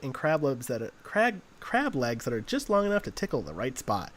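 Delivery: upbeat hammed-up commercial voice; mock TV commercial pitch; cheesy ad read. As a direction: sell the sushi place like a late-night ad. Performs a commercial.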